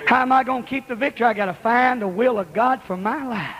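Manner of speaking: ordinary delivery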